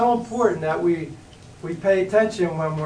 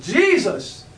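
crying out to Jesus, higher and louder; a sudden desperate call for help; 7 semitones higher, 4 dB louder than usual. Retold or imitated. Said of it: "heart's cry".

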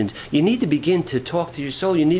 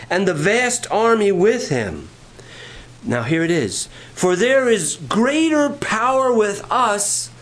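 slow, emphatic pulpit reading; preaching scripture; 4 semitones higher, slower than usual. Acted. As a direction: declaim the verse with rising power. Preacher reads scripture with force.

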